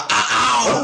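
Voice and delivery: high-pitched, drawn-out joke exclamation; mimicking a mistake with comic force; exaggerated mock shout. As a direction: blurt it out high and overblown. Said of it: "I'm always like".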